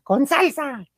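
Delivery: high vendor cry; imitating vendor. Host mimicking vendor to producer, high call. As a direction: mimic the stall lady for a friend. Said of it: "le digo a la productora".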